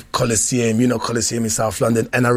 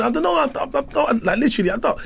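high, stammering mock protest; panicked protest; 14 semitones higher than usual. Son denying smoking to his dad, panicked protest. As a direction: stammer out a denial, pitch way up.

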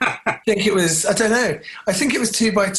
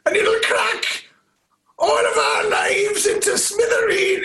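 slow, pleading mock Welsh accent, climbing much higher into a shout; hammed-up Welsh phone plea that ends in a high-pitched yell; imitating a strained, not-quite-Welsh accent, slow and desperate, rising to a shout; Tom Hardy's odd Welsh accent; 8 semitones higher, slower than usual. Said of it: "not quite Welsh accent".

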